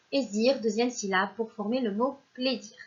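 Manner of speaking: normal talking voice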